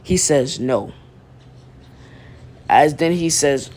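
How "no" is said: serious refusal. Retold or imitated. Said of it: "his face turned serious as hell".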